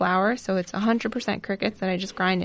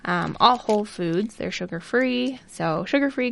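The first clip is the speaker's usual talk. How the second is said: ordinary talk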